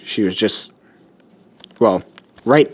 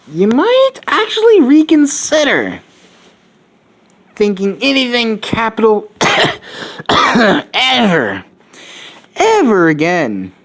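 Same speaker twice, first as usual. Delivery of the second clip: higher, slow, preachy warning; lofty lecture; 10 semitones higher than usual. Acted. Izuku lecturing May on capitalism, high and preachy. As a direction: warn them like a solemn prophet.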